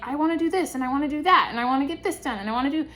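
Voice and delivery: fast, breathless eager list; eager rush. Pretend. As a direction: rattle off plans all at once. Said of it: "you're really like".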